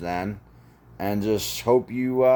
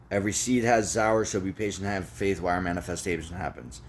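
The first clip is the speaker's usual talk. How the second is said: quick recitation; faster than usual. Shown as a demonstration. Reciting the mantra to listeners, quick recitation. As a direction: rattle it off briskly. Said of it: "One more time".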